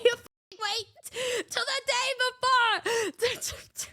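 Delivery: slow, high, laugh-cracked scolding; laughing indignation. Retold or imitated. Scolding the friend, slow and laughing. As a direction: scold her while cracking up.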